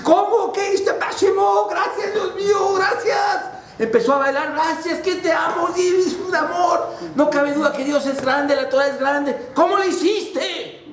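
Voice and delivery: man dancing and thanking God at the top of his voice; ecstatic shouting. Acted. Man rejoicing, dancing with joy.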